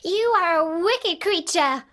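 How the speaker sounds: put-on high, loud upset girl; Dorothy crying out in anger; angry cry. A narrator's reading. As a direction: cry out at the witch. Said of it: "cried Dorothy".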